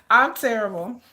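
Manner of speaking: normal talking voice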